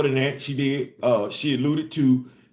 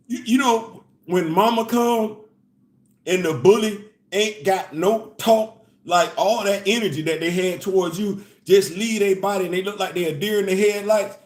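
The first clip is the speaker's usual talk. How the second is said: ordinary talk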